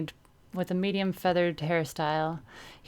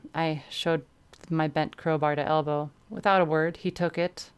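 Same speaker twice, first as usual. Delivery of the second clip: usual speaking voice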